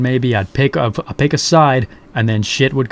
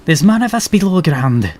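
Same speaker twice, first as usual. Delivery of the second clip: lightly voiced Scottish sergeant; 6 semitones higher than usual. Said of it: "I don't know why she's Scottish".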